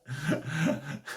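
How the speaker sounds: short, soft real chuckle. Real, not acted. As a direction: laugh quietly at your own joke. Laughs at own accent attempt.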